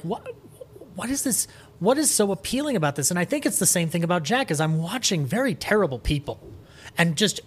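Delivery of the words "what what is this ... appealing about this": high, quick, bewildered questions; baffled wonder. Retold or imitated. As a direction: sound stunned by what you just saw. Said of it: "I was like".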